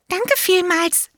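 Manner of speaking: high, eager little elephant voice; elephant child. A narrator's reading. Elephant child answers the python in a high childlike voice.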